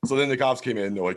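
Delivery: normal talking voice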